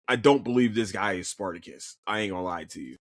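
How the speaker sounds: ordinary talk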